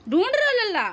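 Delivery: excited high-pitched pet talk. Real, not acted. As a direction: gush at the dog, high and loud. Talking to the dog.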